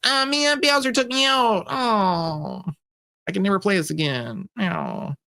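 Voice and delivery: high mock wail, sinking toward the end; hammed-up childish whining in defeat; devastated kid. Kid taken out by Bowser, mock-crying despair. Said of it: "and you're like".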